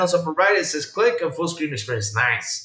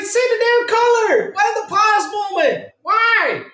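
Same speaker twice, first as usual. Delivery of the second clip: hammed-up fed-up viewer yelling; mock yell at TV; slower than usual. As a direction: shout at the screen in frustration. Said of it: "And you're like".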